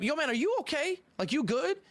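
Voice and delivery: concerned check-in. Retold or imitated. Storyteller checking on an angry man, concerned. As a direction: check on him, voice lifted.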